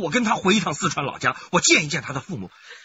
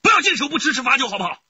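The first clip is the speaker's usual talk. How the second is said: much higher, louder threat; menacing threat; 11 semitones higher, 5 dB louder than usual. Acted. Husband threatens his wife into divorce, loud and menacing. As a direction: threaten her hard.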